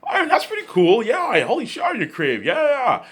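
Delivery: high squeaky gushing praise; excited praise. Retold or imitated. Peter Jackson gushing to Ivan about his idea, high and excited. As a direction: gush over the idea in a high voice. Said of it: "Peter Jackson and, and Grim Talk".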